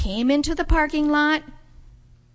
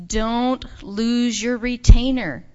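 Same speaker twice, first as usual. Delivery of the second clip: slow, loud parental warning; stern warning; 8 dB louder, slower than usual. Acted. Mommy warning Nisha before leaving, slow and stern. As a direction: spell out the warning slowly and firmly. Mother voiced warning her child.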